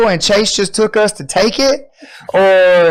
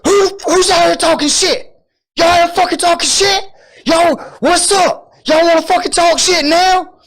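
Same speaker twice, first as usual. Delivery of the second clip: screaming; 12 semitones higher than usual. Retold or imitated. Guy bursting out the door yelling at the group, screaming. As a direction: yell it like a guy picking a fight. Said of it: "And he's just screaming".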